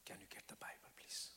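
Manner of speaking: whispered, very soft plea. Retold or imitated. Sick wife whispering to her husband, very soft. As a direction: barely breathe the request to the husband. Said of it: "my wife said in a very soft voice".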